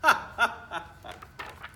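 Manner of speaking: breathy giggle after punchline; light airy laugh; giggle at own joke. Real, not acted.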